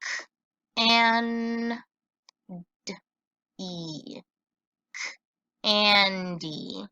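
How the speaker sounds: K and D E split into single sounds; sounding out. Shown as a demonstration. Teacher sounding out a word for the class, sound by sound. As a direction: sound out K-and-D-E slowly. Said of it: "let's sound this out".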